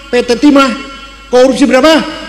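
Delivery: ordinary delivery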